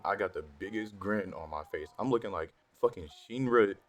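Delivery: usual speaking voice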